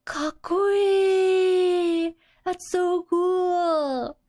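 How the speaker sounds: high, drawn-out, loud gushing "Kakkoi!"; dreamy admiring cry, stretched long; starry-eyed awe. Acted. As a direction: gush over something dazzling, slowly. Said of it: "She's starry-eyed".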